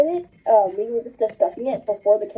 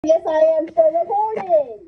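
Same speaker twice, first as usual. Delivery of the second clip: raised pitch, calling through a door; 6 semitones higher than usual. Real, not acted. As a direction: answer a pestering sibling from another room. Calls out to little brother.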